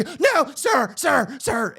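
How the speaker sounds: high, loud mock panic; mimicked alarmed yelping; freaking out. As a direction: imitate a flustered gatekeeper calling after someone. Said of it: "And she's freaking out".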